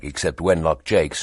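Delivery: ordinary delivery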